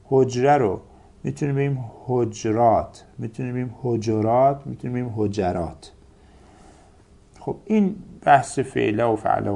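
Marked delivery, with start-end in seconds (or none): 2.0-3.0 حجرات said "hoj-rat"; two syllables in حجرات, no vowel after J; vowelless middle consonant. Shown as a demonstration. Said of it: "سه وجه جایزه". 3.8-4.7 حجرات said "ho-jo-rat"; three syllables in حجرات, middle vowel O. Shown as a demonstration. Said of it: "سه وجه جایزه". 5.2-5.9 حجرات said "ho-ja-rat"; three syllables in حجرات, middle vowel A. Shown as a demonstration. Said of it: "سه وجه جایزه".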